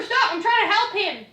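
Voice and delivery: ordinary talk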